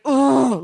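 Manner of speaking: mock exasperated growl-groan; angry groan. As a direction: imitate his furious groan. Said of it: "like, really angry".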